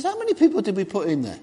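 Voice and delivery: high, quick, loud question; Nebuchadnezzar. Acted. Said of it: "Nebuchadnezzar looks into the fiery furnace".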